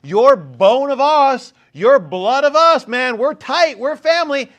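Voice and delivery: loud, slow, high fake-friendly plea; mock pleading tribes. Tribes sucking up to David, loud and slow. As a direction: ham up the fawning flatterers.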